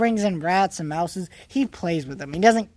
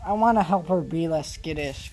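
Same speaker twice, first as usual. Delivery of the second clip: usual speaking voice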